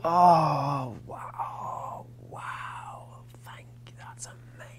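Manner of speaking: breathless whispered fake gratitude; hammed-up hushed amazement; mock awe. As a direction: start high, fade to an awestruck whisper. Acting out underwhelmed thanks for rose.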